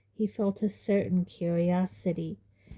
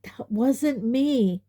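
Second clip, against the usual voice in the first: slow, measured denial, keeping it together; calm denial; 5 semitones higher, 5 dB louder, much slower than usual. Acted. Harry calmly denying it to his uncle, slow and measured. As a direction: stay calm and spell it out slowly. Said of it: "slightly less calmly".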